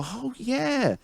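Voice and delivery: high, airy "oh yeah" of someone who forgot; sudden high falsetto "oh yeah"; mock remembering. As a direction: squeak it out like a vague memory. Mocking forgetful people.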